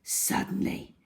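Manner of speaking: ordinary delivery, nothing marked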